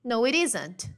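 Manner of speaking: "it isn't" said quickly with the T as a tapped R; quick casual "no, it isn't"; fast connected speech. Shown as a demonstration. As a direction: flap the T in "it isn't", run it together. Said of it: "Falando rapidinho".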